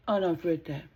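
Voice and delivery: ordinary talk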